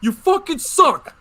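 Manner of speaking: a host mocking the clip with a slow, high insult; mock shout. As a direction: ham up the pitcher's insult. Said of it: "Like he said".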